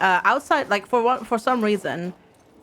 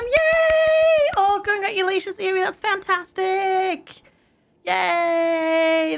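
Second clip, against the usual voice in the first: high sung cheer; excited cheer; 9 semitones higher, slower than usual. Real, not acted. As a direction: sing out a delighted yay. Cheering viewer's good news.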